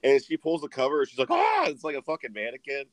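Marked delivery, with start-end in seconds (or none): 1.3-1.7 sudden high-pitched mock scream; mock shriek. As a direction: imitate a startled grandma's shriek. Grandma finds mannequin under covers.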